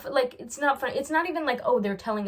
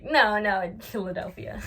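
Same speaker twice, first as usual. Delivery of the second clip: mock amusement; 3 dB louder than usual. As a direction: pretend it's hilarious, starting loud. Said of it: "Why are you laughing".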